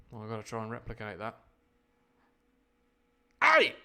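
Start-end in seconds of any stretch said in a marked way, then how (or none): 3.4-3.7 short high yell; sudden loud, high shout; mock cry of anger. Shown as a demonstration. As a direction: yelp out a distant angry cry. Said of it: "That's the cry of anger".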